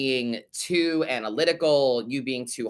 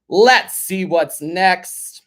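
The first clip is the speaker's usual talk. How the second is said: loud, high, very slow transition line; drawn-out announcement; 4 semitones higher, 9 dB louder, much slower than usual. Real, not acted. Host moves on to the next listener letter, drawn-out announcing. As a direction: stretch each word out, big and loud.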